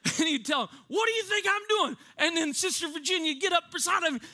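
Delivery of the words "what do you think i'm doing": high-pitched mock preaching; fired-up preacher. Dad mid-sermon to his church, fired up. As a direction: imitate a preacher working up the crowd. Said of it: "getting fired up".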